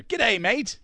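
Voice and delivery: a quick put-on Australian greeting, rising on mate; playful Australian accent on a radio greeting; Aussie accent. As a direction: greet him like a friendly Aussie bloke. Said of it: "I made it sound like you're an Aussie".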